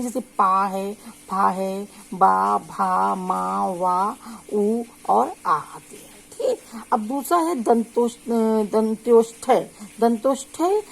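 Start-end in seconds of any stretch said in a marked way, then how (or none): 0.0-6.1 पा फा बा भा मा वा said slowly and clearly with both lips; slow teacher's lip-sound drill; lip sounds demo. Shown as a demonstration. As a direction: spell out each lip syllable, drawn out. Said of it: "इसमें शब्द कौन कौन से आते हैं".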